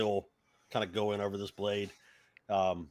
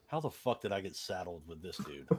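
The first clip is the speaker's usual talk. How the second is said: exasperated mutter; 3 semitones lower, 9 dB quieter than usual. Acted. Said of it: "looking at you with this, like".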